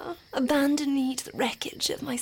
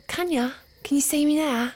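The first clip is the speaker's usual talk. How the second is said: mocking taunt; 5 semitones higher, 7 dB louder, faster than usual. Taunting reveal builds.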